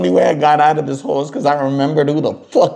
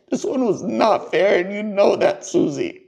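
fighting back tears; 6 semitones higher than usual. Real, not acted. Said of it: "trying not to cry".

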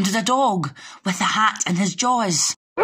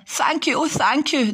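raised, gushing thanks; grateful cry; 8 semitones higher than usual. A narrator's reading. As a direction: thank them from the heart. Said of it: "the grateful witch cried".

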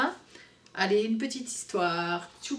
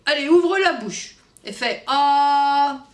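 slow, louder doctor voice; doctor's instruction; 4 semitones higher, 8 dB louder, slower than usual. Acted. The doctor asking Tchoupi to open his mouth, slow and coaxing. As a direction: coax the child to open wide.

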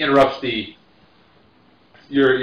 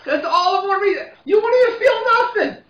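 put-on much higher, shouted drunk voice; drunk shouting; 3 dB louder than usual. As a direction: yell it at him. Drunk pushing the bet.

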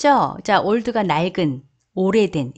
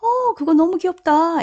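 higher delighted "너무 귀엽다"; gushing; 9 semitones higher than usual. Shown as a demonstration. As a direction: coo over something cute. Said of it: "의역을 하면요". (높은 톤으로 감탄하며)

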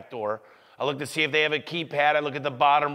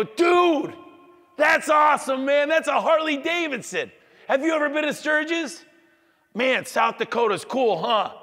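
much higher, loud excited gushing; hyped-up fan; 11 semitones higher, 3 dB louder than usual. Retold or imitated. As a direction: gush over the Harley like a thrilled fan. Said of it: "I'm gonna be like, "Pretend owner".

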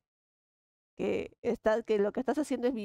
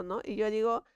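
ordinary talk

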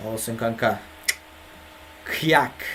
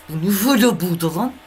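higher and louder, caught off guard; startled outburst; 6 semitones higher, 5 dB louder than usual. Real, not acted. Streamer spots something weird on screen, startled loud reaction.

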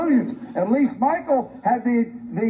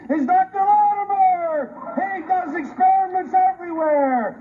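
indignant shout; 8 semitones higher than usual. Real, not acted. Indignant outburst at press conference.

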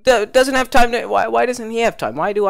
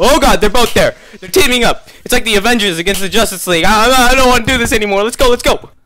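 loud, high, fast panic; panicked; 4 semitones higher, 5 dB louder than usual. Real, not acted. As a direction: flee in a panic. Chased by two enemies.

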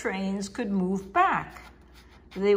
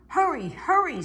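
high, eager urging; chirpy coaches calling hurry; 8 semitones higher than usual. Acted. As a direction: hurry him along in a high voice.

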